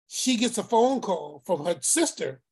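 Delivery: ordinary talk, nothing marked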